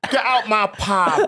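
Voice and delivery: hammed-up pub throw-out; mock EastEnders landlord. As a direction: bark like a soap landlord.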